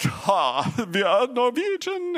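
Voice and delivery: hammed-up German accent boast. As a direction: play the proud Norwegian in a fake German accent. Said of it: "I just did a German accent".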